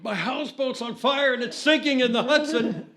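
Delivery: high, loud, mock panic through a laugh; overdramatic. Jack faking panic about his houseboat, hammed-up and high. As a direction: ham up the disaster. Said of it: "he's being overdramatic".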